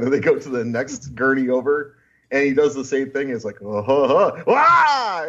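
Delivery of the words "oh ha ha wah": mock chuckle building to a high, loud cry; hammed-up reveal. Attendant pulling the sheet at the next gurney, hammed-up reveal. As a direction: imitate a gleeful unveiling.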